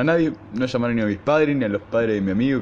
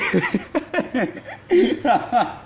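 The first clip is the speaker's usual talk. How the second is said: a long high fit of laughter; 13 semitones higher than usual. Real, not acted. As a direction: keep laughing, high and helpless. Laughing at own impression. (carcajada larga y aguda)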